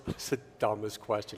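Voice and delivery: very low, quiet mock put-down; imitated dismissive colleague. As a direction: imitate her, dropped low and under the breath. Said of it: "she goes".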